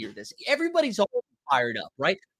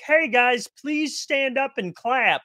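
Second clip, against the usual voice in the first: higher, slow, hammed-up announcer plea; Jumbotron hype voice; 7 semitones higher, slower than usual. The Jumbotron begging bored fans to cheer, in a hammed-up announcer voice. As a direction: coax the crowd like a cheesy stadium screen. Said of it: "the Jumbotron is like".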